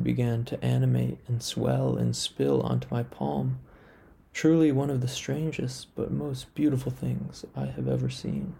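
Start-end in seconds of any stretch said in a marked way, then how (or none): none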